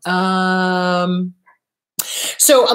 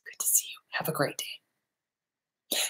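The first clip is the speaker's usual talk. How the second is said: whispered, much softer, slow parting words; soft whispered goodbye; 5 semitones lower, 15 dB quieter, slower than usual. Shown as a demonstration. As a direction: whisper a gentle goodbye to your child. Healthy parent voice, soft goodbye.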